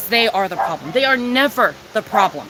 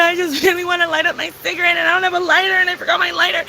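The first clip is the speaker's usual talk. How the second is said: high, whiny wail retelling a meltdown; hammed-up crying over nothing to light up with; mock crying; 5 semitones higher, faster than usual. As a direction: imitate your own tearful panic, pitched up and whining. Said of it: "I was crying 'cause my freaking lighter wasn't working".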